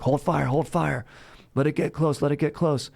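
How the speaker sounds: a lightly acted commander reining in his gunners; calling out orders. Union officers calling out orders.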